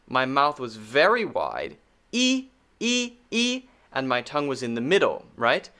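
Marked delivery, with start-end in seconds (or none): 2.1-3.1 E said as the long vowel ee, much higher. Shown as a demonstration. Teacher recalling long E, mouth wide. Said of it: "when I did the long E sound".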